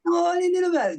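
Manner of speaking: put-on very high baby-talk voice. Shown as a demonstration. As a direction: coo like talking to a puppy. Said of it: "Just really high and really, like, total baby talk".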